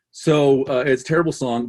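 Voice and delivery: usual speaking voice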